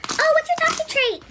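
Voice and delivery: squeaky high mock voice of a nosy friend; mock prying question. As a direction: ape the question in a silly high voice. Mimicking someone asking.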